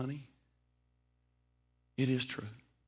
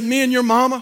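excited; 9 semitones higher, 11 dB louder than usual. Acted. Preacher overcome, rising.

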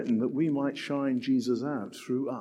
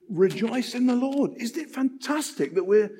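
higher, rising with delight; excited; 8 semitones higher than usual. Real, not acted. Preacher to congregation, delighted and much higher. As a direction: lift it up with joy.

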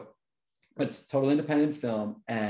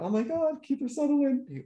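much higher, gushing with admiration; awed fan; 14 semitones higher than usual. Retold or imitated. Fan admiring the actor's tear, gushing high. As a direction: swoon over the actor's brilliance. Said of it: "I thought".

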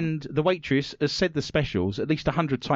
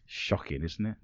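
ordinary talk, nothing marked

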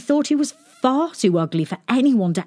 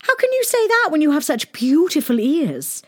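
higher, doting princess voice; cartoon princess; 10 semitones higher than usual. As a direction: gush like a smitten princess. Princess character voice.

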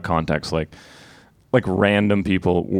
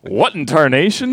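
hammed-up folksy old-timer, higher and louder; old prospector; 8 semitones higher, 4 dB louder than usual. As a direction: crank up the cowboy twang. Joking old-timer exclamation.

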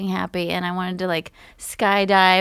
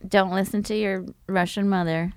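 ordinary delivery

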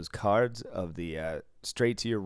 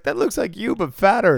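high, loud mocking girl voice; high, loud girl voice saying he looks fatter; teasing girl; 10 semitones higher, 8 dB louder than usual. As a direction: tease him playfully, pitched up high. Girl teases him at party.

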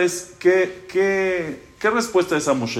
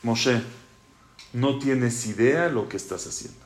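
calm him gently, unhurried; gentle reassurance; 5 semitones lower, slower than usual. Acted. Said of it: "Hashem le dice".

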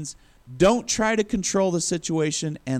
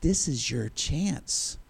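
mock whisper; 2 semitones lower, 6 dB quieter, slower than usual. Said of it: "his men are whispering".

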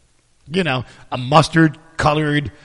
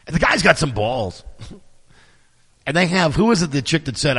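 ordinary talk, nothing marked

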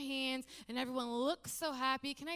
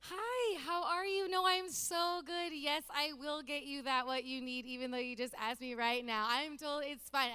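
fake cheerful; 2 semitones higher than usual. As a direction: put on a fake smile. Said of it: "the person that smiles when I talk".

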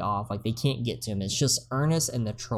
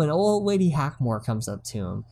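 ordinary delivery

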